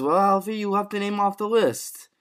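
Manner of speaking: high-pitched acted accusation; put-on voice, pitched well up; Tommy's line. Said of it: "And Tommy says".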